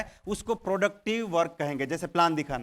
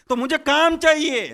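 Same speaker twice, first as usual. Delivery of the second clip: loud insistence; 7 semitones higher, 8 dB louder than usual. Acted. Ghost insists on work.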